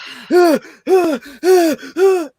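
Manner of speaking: a sharp, high, gasping breath; imitated panicked gasp. Retelling a man in the store corner, panicked gasp. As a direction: gasp like a man freaking out on meth. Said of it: "I find this guy in the corner doing".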